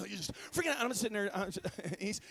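ordinary talk

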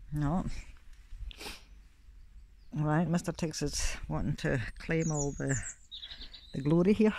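ordinary talk, nothing marked

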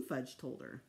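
plain reading voice

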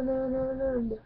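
ordinary talk, nothing marked

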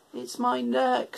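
a gentle giraffe, a touch high. A narrator's reading. Giraffe telling George her neck is cold, lightly voiced. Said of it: "said the giraffe".

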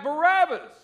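high, loud shout of the name; crowd shouting. Retold or imitated. Preacher voices the crowd shouting for Barabbas, loud. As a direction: yell it back like a mob. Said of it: "And the crowd shouted back".

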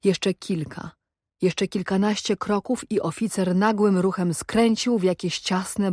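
ordinary delivery

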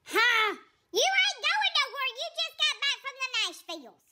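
lightly put-on sassy cat voice; sassy cat Willow. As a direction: talk back like an offended diva cat. Willow doubts the trip.

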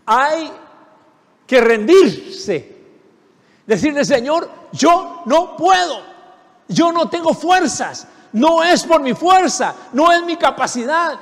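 high, loud, shouting prayer of surrender; impassioned preaching. Acted. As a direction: cry out to the Lord that you have no strength.

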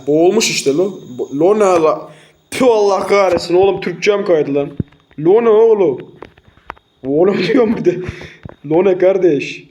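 loud, high-pitched Adana dialect slipping out; Adana accent. Real, not acted. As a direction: exclaim in a broad Adana accent. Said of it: "Adana şivesiyle konuşmaya başladın".

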